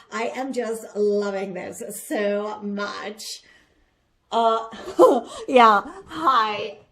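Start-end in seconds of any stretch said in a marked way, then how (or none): 4.7-5.4 burst of laughter. Real, not acted. Laughs at own intro.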